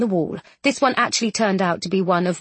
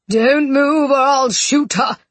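loud, higher, drawn-out threat; crying out; 5 semitones higher, 5 dB louder, slower than usual. A narrator's reading. Duke holding Jane at gunpoint, crying out. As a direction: call out a slow, forceful warning. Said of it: "he cried".

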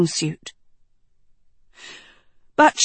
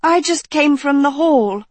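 skeptical; 7 semitones higher than usual. A narrator's reading. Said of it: "said Sophie skeptically".

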